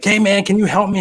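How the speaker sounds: high, loud call out of the hole; calling up for help. Acted. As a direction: shout up to the passer-by. Man in hole begs friend.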